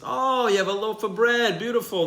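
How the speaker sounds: high-pitched, smiling admiration; delighted. Retold or imitated. Visitor seeing the shaped loaf, delighted. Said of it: "Smiling ear to ear".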